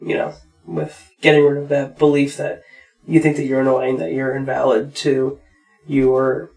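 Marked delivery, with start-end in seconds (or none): none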